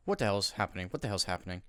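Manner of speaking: ordinary talk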